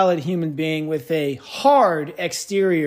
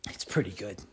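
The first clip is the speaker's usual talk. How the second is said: put-on Australian accent; 10 semitones lower, 13 dB quieter than usual. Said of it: "I can't even do a good accent".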